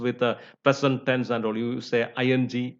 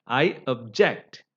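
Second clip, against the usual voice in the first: slow I object, weight on JECT; stress on second syllable; slower than usual. Shown as a demonstration. Teacher gives the verb in use, object stressed on JECT. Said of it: "stress is on the second syllable".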